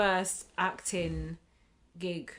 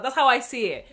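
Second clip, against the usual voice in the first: ordinary talk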